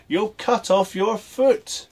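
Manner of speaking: high, loud, slow warning from father; a father's slow shouted warning; father shouting. A narrator's reading. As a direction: call it out sternly, slowly. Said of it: "his father shouted".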